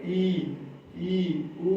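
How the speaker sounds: ई and उ said slowly, mouth barely open; slow model of the closed vowels; closed vowels demonstrated. Shown as a demonstration. Teacher showing students the closed mouth, slow vowels. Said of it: "जैसे देखिए".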